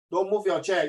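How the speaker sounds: ordinary talk, nothing marked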